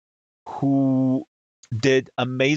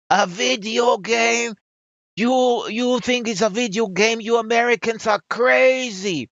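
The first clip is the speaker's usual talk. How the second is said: high, hammed-up French outrage; incredulous French-accented shouting, stretched words; mock scream; 10 semitones higher than usual. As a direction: shriek it as an appalled French woman. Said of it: "screams at me".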